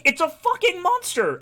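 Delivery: high-pitched mock outcry; outraged villagers. Villagers defending themselves to Geralt for attacking a troll, indignant. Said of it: "And they're like".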